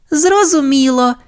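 very high, obedient cartoon reply; pup pilot. A narrator's reading. Sky answers Ryder's instruction, high pup voice.

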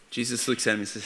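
ordinary delivery

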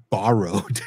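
put-on "borrowed" in a Canadian accent, with an open "bar" vowel and a laugh. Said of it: "the way that you said bo".

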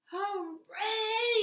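high, drawn-out cheer; happy cry. A narrator's reading. Hedgehog cheers the rain at last, happy cry. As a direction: let out a happy hooray. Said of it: "he cried happily".

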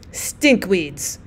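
sharply. Acted. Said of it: "said the other old woman sharply".

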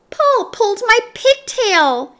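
high, indignant tattletale voice; high-pitched schoolgirl complaint; tattling schoolgirl. A narrator's reading. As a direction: tell on him to the teacher. Girl tattles on the puller.

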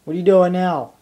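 ordinary delivery

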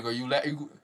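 normal talking voice

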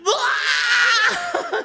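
high, loud mock fangirl scream; imitated wife's scream. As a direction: shriek like your wife losing it with joy. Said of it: "my wife was like".

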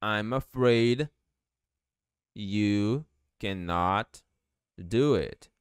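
very slow delivery in separated chunks; word-by-word language-teacher demonstration; slow model sentence. Shown as a demonstration. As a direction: draw out each word for learners. Teacher builds the English sentence slowly.

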